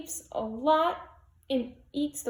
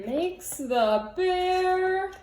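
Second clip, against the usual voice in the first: slower and higher, tense; higher drawn-out build-up; slow suspense build; 4 semitones higher, much slower than usual. A narrator's reading. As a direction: hold the moment before the roar. Repeated, higher, stretched.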